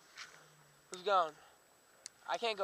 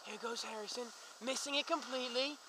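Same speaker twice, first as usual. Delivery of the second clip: hushed play-by-play voice; sports commentator; 5 semitones lower, 12 dB quieter than usual. Acted. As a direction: call the run like a commentator.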